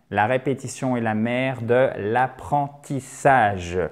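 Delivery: ordinary talk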